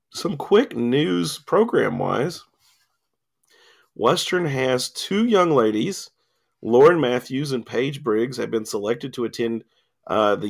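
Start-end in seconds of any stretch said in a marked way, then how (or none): none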